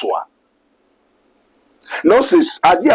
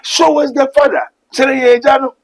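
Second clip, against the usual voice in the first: pleading higher request; Thomas asking; 8 semitones higher than usual. Retold or imitated. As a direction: voice Thomas asking Jesus, lifted. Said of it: "Thomas asked Jesus Christ".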